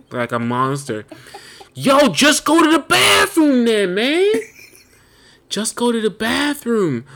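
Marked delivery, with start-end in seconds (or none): 1.7-4.3 loud, high, drawn-out exasperated shout; acted-out yell. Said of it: "I wanna just yell and curse and scream". 5.5-7.0 high, slow, less forceful complaint; exasperated pleading. Retold or imitated. Repeats the rant, easing off.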